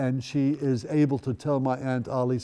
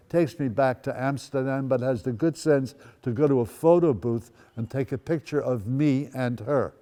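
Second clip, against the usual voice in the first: ordinary talk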